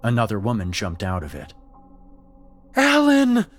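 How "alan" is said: high, loud full-lunged call; shouting a name. Acted. Paige calling her friend at the top of her lungs. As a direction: scream the name across the field. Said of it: "called at the top of her lungs".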